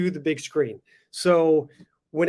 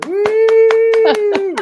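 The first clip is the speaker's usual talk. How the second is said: loud high-pitched whoop; excited cheer; 12 dB louder than usual. Acted. Host cheers guest's entrance.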